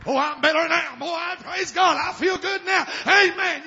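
high, loud, hammed-up testimony; mock jubilation. Preacher mocking a vitamin taker's cure, loudly jubilant. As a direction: shout praise as if instantly cured.

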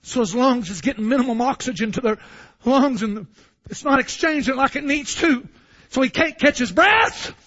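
normal talking voice

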